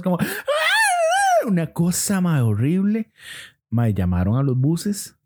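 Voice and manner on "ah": very high drawn-out shriek; piercing mock scream; imitated witches' scream. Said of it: "como dando gritos".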